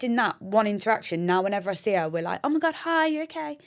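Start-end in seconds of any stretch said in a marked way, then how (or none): 2.4-3.6 high-pitched, gushing mock hello; excited greeting. Greeting her new gym friend, excited high voice. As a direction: act out greeting a new pal, voice lifted high.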